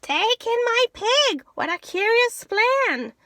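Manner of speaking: put-on high, loud pleading old lady. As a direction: beg loudly like a flustered little old lady. Old lady answers wise man.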